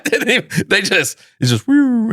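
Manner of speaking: high-pitched laughing through the words. Real, not acted. Laughing at his own blunder.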